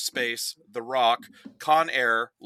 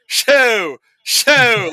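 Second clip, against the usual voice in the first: loud, high, hammed-up shooing; mock frantic shooing; 8 semitones higher, 11 dB louder, much slower than usual. Nick Cage shooing a bat out the window, loud mock panic. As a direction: shoo the bat out like a panicked banker. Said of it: "he literally goes".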